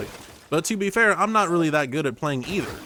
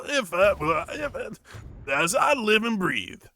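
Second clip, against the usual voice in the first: put-on high, slow, stammering old-timey character; hammed-up old-timey voice; 10 semitones higher, slower than usual. Streamer greeting imaginary Chicken Bone in a high old-timey voice. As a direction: play an overjoyed old-timer, stuttering high.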